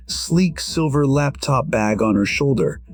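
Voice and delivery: ordinary talk, nothing marked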